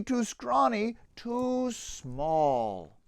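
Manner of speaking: usual speaking voice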